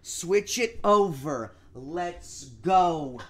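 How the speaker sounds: loud hyped chant, repeated; excited shouting. Real, not acted. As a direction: pump up the room, shouting. Hyped chant to switch the game.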